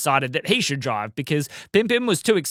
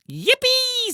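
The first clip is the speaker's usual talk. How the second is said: very high squeal of yippee; excited cheer. A narrator's reading. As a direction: squeal with joy. Pim Pim cheers.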